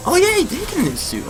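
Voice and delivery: ordinary delivery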